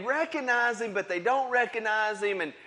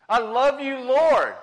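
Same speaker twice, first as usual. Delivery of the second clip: loud protest; 6 semitones higher, 7 dB louder, slower than usual. Acted. Peter protests his love loudly.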